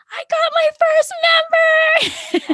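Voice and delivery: very high squealing announcement; excited. Retold or imitated. She shares her first member with friends, squealing. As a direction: squeal the news out. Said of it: "texted you and Angela".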